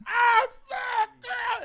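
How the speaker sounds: very high, loud agonized yell; imitated pain scream. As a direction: scream as if hanging from a spike. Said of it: "you just see him go like".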